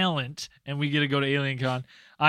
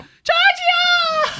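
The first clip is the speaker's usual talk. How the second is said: screamed name, very high; high-pitched scream; 6 dB louder than usual. Retold or imitated. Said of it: "I legit screamed Giorgio".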